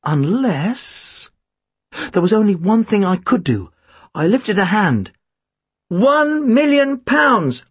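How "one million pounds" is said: high, slow calling voice; high-pitched bid, each word stretched; called out. A narrator's reading. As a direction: call out a bid across the room, drawn out. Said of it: "I called out".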